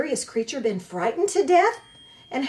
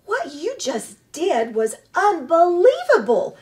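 higher, louder, amazed robot praise; robot impressed; 7 semitones higher, 7 dB louder than usual. A narrator's reading. The robot praising the opossum's fake death, amazed. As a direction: tell her it was amazing. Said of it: "said Roz".

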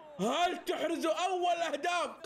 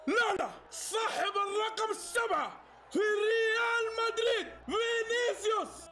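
excited goal call; 8 semitones higher than usual. Real, not acted. Calling the goal live for viewers, a high excited shout. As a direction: shout out the scorer like a live commentator.

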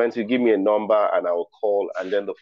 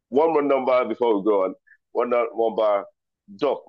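ordinary talk, nothing marked

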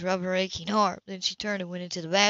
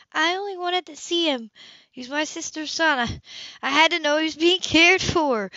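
higher, earnest pleading sister; higher-pitched woman pleading to be believed; Sybil's voice; 9 semitones higher, 4 dB louder than usual. A narrator's reading. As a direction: plead your case gently, voice lifted. Sybil pleading about nephew.

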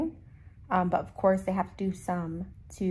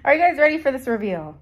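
fast, loud, high hype; excited; 5 semitones higher, 8 dB louder, faster than usual. Real, not acted. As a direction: build hype for the big reveal. Hyping the teeth reveal.

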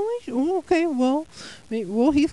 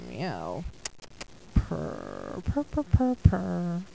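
low rumbling mock purr; cat meow and purr; 4 semitones lower, 4 dB quieter, much slower than usual. Cat character meows and purrs.

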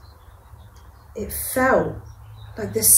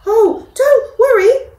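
high, loud, cheerful mouse voice; a small mouse reassuring a big lion; peeping mouse; 12 semitones higher, 10 dB louder than usual. Acted. Mouse reassuring the scared lion, high and bright. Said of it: "Mouse peeped".

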